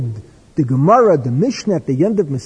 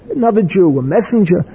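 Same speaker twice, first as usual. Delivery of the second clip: ordinary delivery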